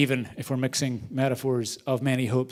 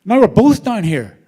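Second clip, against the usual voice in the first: much higher, louder exasperated outburst; man in the hole scolding the friend who jumped in; incredulous; 8 semitones higher, 9 dB louder than usual. Retold or imitated. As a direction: scold him for jumping in. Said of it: "The guy looks and says".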